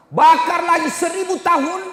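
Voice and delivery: high, loud preaching shout; dramatic shout. Acted. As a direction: thunder it out at a high pitch. Seruan keras tentang api neraka. (seruan lantang berapi-api)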